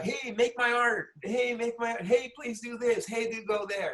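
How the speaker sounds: high, loud imitation of eager askers; imitated pleas piling on one another; pestering requesters. Mimics people pestering for favours.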